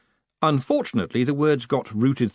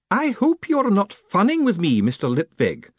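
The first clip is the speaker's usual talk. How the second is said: a formidable lady laying down the law; imperious high-pitched battleaxe; demanding old woman; 11 semitones higher, 4 dB louder than usual. Acted. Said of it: "she demanded".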